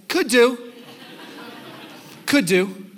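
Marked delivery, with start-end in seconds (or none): 0.1-0.7 mock noncommittal. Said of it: "The answer should always be yes".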